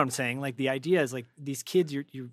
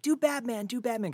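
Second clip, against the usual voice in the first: higher-pitched imitation of a pleading kid; eager kid; 10 semitones higher than usual. Voice actor retelling a camp kid's request, imitated eager kid. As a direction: call it out like an excited kid. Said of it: "one of the kids is like".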